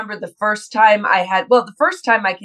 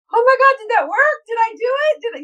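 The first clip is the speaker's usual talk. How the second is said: high-pitched, hurried self-questioning; mock panic; 14 semitones higher, faster than usual. Actress replaying her doubts right after a take, in mock panic. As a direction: fret in a squeaky, exaggerated fluster.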